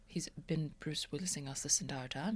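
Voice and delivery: hushed conspiratorial whisper; mock secretive whisper. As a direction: whisper back in on the joke. Conspiratorial aside.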